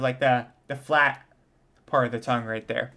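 normal talking voice

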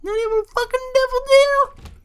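mock-irritated squeal, extremely high; exaggerated high voice. Shown as a demonstration. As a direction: replay the complaint in absurd falsetto. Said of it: "My voice gets all high".

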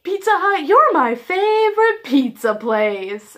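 loud, slow, gushing declaration; blurted excited praise. Retold or imitated. Prank caller gushing to Pizza Hut, loud and slow. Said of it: "first thing that pops into my mind is".

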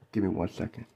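ordinary delivery

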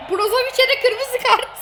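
high, laughing through it. Real, not acted. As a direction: giggle at the red card. Laughing at red card. (kırmızı karta gülerek)

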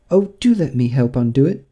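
put-on high girl's voice, eager to help; eager Alice. A narrator's reading. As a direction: offer help brightly and anxiously. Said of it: "always ready to make herself useful".

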